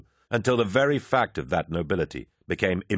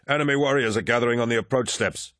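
quick urgent call, a touch higher; 5 semitones higher, faster than usual. A narrator's reading. Warrior calls a warning to his commander, quick and raised. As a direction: warn the commander fast across the field. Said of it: "Kyrus called out".

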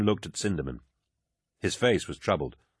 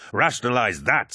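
louder, higher, biting challenge; angry challenge; 5 semitones higher, 5 dB louder than usual. Acted. Loken challenges the Iterator to explain it, angry and louder. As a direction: throw it at him as a dare.

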